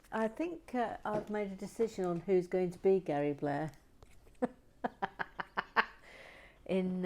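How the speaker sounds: ordinary talk, nothing marked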